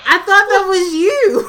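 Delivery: high, loud, helpless laughter; barely getting words out for laughing; laughing hard. Real, not acted. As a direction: squeal it out while cracking up. Cracking up over mistaken hiss.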